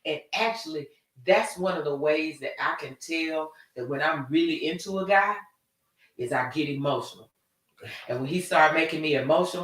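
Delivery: usual speaking voice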